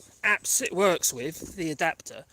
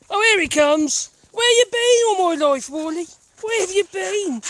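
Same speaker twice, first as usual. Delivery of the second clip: greeting the returning dog; 11 semitones higher, 10 dB louder than usual. Real, not acted. Owner sees lost Wally come back, excited high greeting. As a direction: make a big fuss of the dog.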